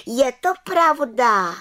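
high, loud, drawn-out affirmation; calling out. A narrator's reading. Little witch answers head witch, calling out proudly. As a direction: affirm "je to pravda" slowly in a high voice. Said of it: "volala malá čarodějnice".

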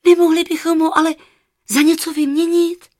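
put-on high, loud child's question; high-pitched, insistent girlish plea; eager little girl. A narrator's reading. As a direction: pipe up hopefully as a small girl. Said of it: "zeptala se Ela".

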